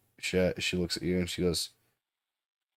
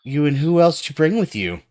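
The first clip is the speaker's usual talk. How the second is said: sharp, higher, louder challenge; suspicious demand; 6 semitones higher, 5 dB louder than usual. Acted. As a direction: demand who came with him. Suspicious NPC confronts player.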